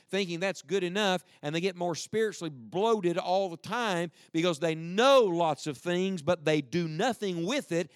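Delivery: preacher's rising sermon voice, pressing hard; preaching. Acted. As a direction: preach it to the pews with force. Preaching to the congregation.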